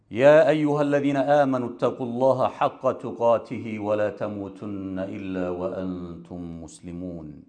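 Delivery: fast, low chanted Arabic recitation; rapid Arabic sermon opening recitation; Quran recitation. Acted.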